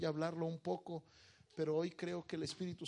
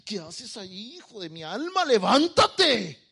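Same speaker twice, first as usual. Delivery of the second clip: high plea building to a drawn-out shout; hammed-up divine scolding, exploding on the last word; God's exasperated command; 6 semitones higher, 13 dB louder than usual. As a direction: rise from pleading to a loud, stretched yell. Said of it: "Dios se para y le dice".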